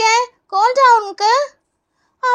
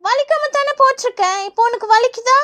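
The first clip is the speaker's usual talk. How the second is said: usual speaking voice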